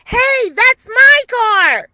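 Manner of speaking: high, loud, slow yell out a window; imitating the neighbor shouting from her window. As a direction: call down from upstairs in alarm. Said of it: "yelling out her window".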